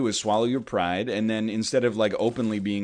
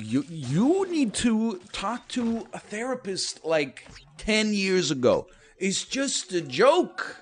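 slow, drawn-out higher put-down; mock exasperated retort; 8 semitones higher, slower than usual. Answering the offended replier, slow and higher. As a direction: stretch it out like you're talking down to them. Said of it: "And I'm like".

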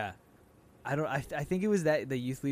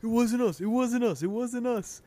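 higher repeated "It wasn't us"; mock insistent denial; 8 semitones higher, 3 dB louder than usual. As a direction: chant the denial. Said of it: "and you're like".

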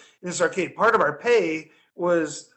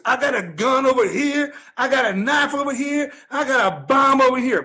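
higher, louder bum bragging about weapons; imitating the bum; 6 semitones higher, 3 dB louder than usual. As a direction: list hidden weapons with mock menace. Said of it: "He goes".

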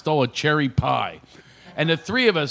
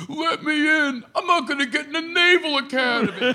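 pleading friend; 10 semitones higher than usual. Retold or imitated. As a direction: beg to be let in, in a squeaky voice. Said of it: "and he goes".

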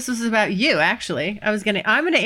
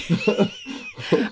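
high burst of laughter. Real, not acted. Laughs at own remark.

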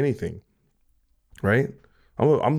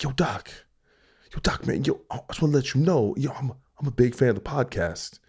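lightly voiced eager fan, whisper then higher; a hint of a keen fan stopping you; 3 semitones higher than usual. Fan greets the host on the street, lightly eager. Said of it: "and you're like".

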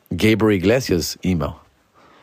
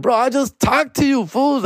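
much higher, indignant bro; surprised protest; 14 semitones higher, slower than usual. Retold or imitated. Comic to friend who kept a secret, high surprised protest. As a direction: squeal it out in surprise.

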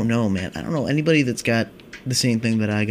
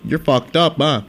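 a cop calling out, higher and louder; mock cop; 5 semitones higher, 4 dB louder, slower than usual. Cop stopping a stoner on the street, louder mock cop voice. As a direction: bust someone like a smug cop. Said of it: "have a cop look at you and go".

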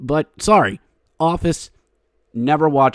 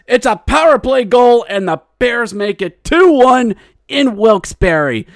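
much higher and louder, shouting with joy; excited goal call; 9 semitones higher, 3 dB louder than usual. Real, not acted. Fan calling his team's power play goal, ecstatic shout. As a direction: yell the goal like a thrilled commentator.

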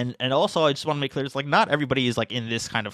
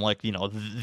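usual speaking voice

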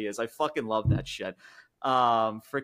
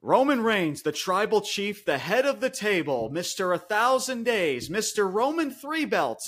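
bill the champion title by title, pitched up; hyped title introduction; 8 semitones higher, 4 dB louder than usual. Acted. Grandly listing the champion's titles.

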